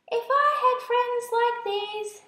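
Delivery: lonely sighing spider. A narrator's reading. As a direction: sigh it out like a lonely hostess longing for company. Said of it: "she sighed".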